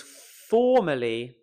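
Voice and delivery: slow "formerly" with a loud, stretched FOR; drawn-out first syllable, throwaway "merly"; stress on FOR. Shown as a demonstration. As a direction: lean hard into FOR in "formerly". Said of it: "The for is what's important".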